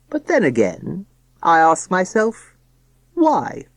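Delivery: slow, grand, rising on why; a showman posing the question; pompous father voice. Acted. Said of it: "solemn, pompous voice".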